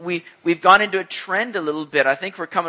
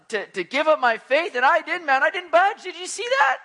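boastful show-off; 6 semitones higher, 4 dB louder than usual. Pretend. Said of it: "nobody's in heaven saying".

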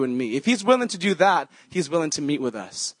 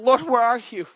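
higher, louder pleading cry to God; frustrated cry; 7 semitones higher, 4 dB louder than usual. Retold or imitated. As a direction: cry out in frustration, asking where the Lord is. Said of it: "And I'm getting frustrated".

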